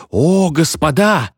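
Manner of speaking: high, drawn-out gasp of wonder; awed excitement at the seance; thrilled exclamation. A narrator's reading. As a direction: marvel at it, stretching the words. Sitters thrilled by the spirit's answer.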